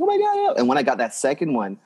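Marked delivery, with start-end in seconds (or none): 0.0-0.5 mock squeal of delight, much higher; thrilled. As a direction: act out being thrilled, leaping up high. Own delight retold.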